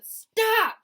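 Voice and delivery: very high, very loud scream. A narrator's reading. Wilbur cutting the sheep off, very high loud scream. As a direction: shriek to cut her off. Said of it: "screamed Wilbur".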